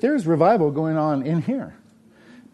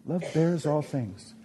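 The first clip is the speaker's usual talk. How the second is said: soft repeat; 8 dB quieter than usual. Acted. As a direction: echo the line much softer. Repeating verse softer.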